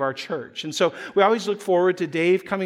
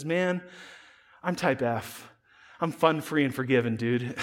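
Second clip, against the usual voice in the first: laid-back musician; 4 semitones lower, 4 dB quieter than usual. Retold or imitated. Laid-back musician quoted in story.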